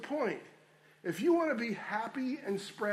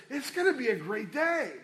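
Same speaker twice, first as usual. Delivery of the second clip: bright and upbeat, a touch higher; cheerful upbeat; 5 semitones higher, 4 dB louder than usual. Shown as a demonstration. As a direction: say it like you mean it, cheerful. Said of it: "let me say this same, same sentence two different ways".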